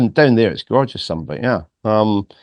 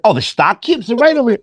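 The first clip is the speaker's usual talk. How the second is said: put-on bright shop girl; 12 semitones higher, faster than usual. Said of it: "she went".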